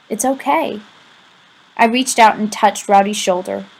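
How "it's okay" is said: soft reassurance; boy softly reassures his crying friend; comforting. A narrator's reading. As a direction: gently soothe him. Narrator comforting again.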